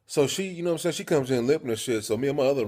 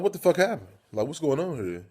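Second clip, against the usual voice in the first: alarmed; 6 semitones higher, 3 dB louder than usual. Retold or imitated. Confronting roommate about blood.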